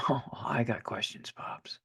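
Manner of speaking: hushed, breathy sass under the breath; whispered retort. Acted. Child whispering a cheeky reply to Dad.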